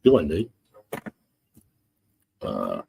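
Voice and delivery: usual speaking voice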